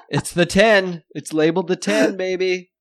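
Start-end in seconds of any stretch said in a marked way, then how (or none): none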